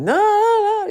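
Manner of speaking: high, loud drawn-out wail of protest; loud, high-pitched kid refusing to budge; toddler flipping out. Retold or imitated. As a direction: imitate a tantruming toddler's squeal. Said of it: "he starts ... flipping out".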